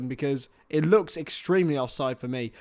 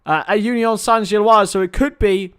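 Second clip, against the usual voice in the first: excited; 3 semitones higher, 7 dB louder than usual. Real, not acted. Other score announced.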